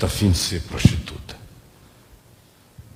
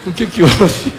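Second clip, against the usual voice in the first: higher, louder mock-innocent question; kindly fake innocence; 5 semitones higher, 7 dB louder than usual. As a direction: feign not knowing, half laughing. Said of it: "Sabe essas falsidades bondosas".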